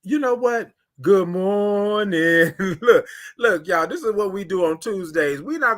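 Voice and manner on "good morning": very slow, drawn-out "Good morning" with the r left off, "mornin'"; signature good morning. Shown as a demonstration. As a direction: stretch out "Good morning" long and lazy, no r sound. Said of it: "That's how you do it".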